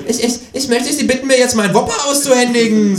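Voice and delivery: high, forceful kangaroo retort; kangaroo parroting the boy. A narrator's reading. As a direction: mimic the stutter, then demand loudly. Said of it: "sagt das Känguru".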